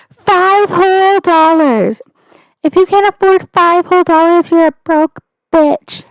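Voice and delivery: mock a snooty streamer begging for money; pretentious influencer. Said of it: "that one chick who was like".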